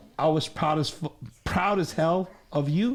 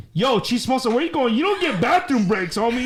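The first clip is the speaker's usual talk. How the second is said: calling out; 7 semitones higher, 4 dB louder than usual. Real, not acted. Calls out to someone leaving.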